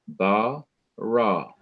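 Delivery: "ba, ra" as two separate syllables. Shown as a demonstration. Teacher breaking the word up for students, syllables separated. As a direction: pause between "ba" and "ra". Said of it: "The two syllables are".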